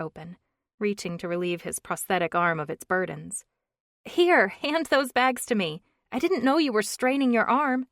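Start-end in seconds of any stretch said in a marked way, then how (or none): 4.0-7.9 put-on young woman voice. A narrator's reading. Brooke, concerned.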